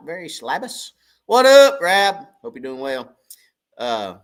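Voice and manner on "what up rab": big high call of the name; loud shout-out. Real, not acted. Streamer hollering hello to a viewer, loud and high. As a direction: holler it like a greeting across the room.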